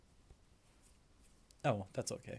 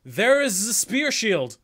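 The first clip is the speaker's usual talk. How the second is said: loud, slow, high courtroom shout; dramatic objection shout; 8 semitones higher, 10 dB louder, slower than usual. Pretend. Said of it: "you could literally translate that to".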